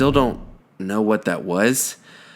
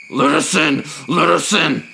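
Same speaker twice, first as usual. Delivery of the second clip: insistent demand; 7 semitones higher than usual. Retold or imitated. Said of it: "it just changed to".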